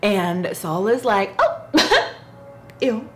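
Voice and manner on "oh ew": shrill grossed-out squeal, then a laugh; playful high-pitched ew at a half-eaten burger; mock disgust. Saul refuses the half-eaten burger.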